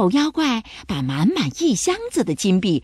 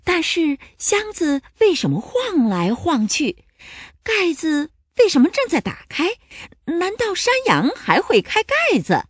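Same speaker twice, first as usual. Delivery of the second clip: puzzled thinking; 7 semitones higher than usual. A narrator's reading. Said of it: "他心想".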